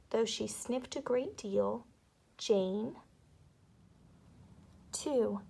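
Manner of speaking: plain reading voice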